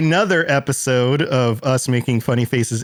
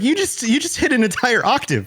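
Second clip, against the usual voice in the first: high teasing reply; 10 semitones higher than usual. Retold or imitated. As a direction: voice pitched well up in mock amazement. Teasing reply to wife's mom.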